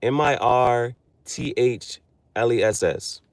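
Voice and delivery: each letter slow and even; measured letter-by-letter read; slow spelling. Shown as a demonstration. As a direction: spell the word out carefully. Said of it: "The word mirthless".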